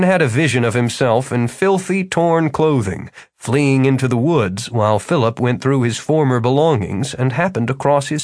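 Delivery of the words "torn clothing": ordinary delivery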